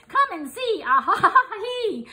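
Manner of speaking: high giggling invitation; laughing. A narrator's reading. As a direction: laugh through it with delight.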